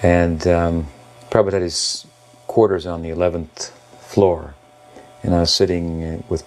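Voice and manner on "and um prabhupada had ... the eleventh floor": usual speaking voice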